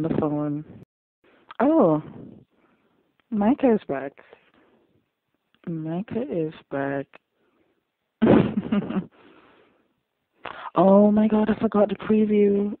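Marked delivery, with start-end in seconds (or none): 1.6-2.0 surprised. Real, not acted. Surprised by Micah's return. 3.3-4.1 louder, higher, excited announcement. Real, not acted. Viewer greeting Micah's return on screen, excited. 10.8-12.8 loud, high gasp of dismay; alarmed realization. Real, not acted. Viewer remembering the preview, alarmed.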